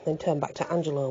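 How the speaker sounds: usual speaking voice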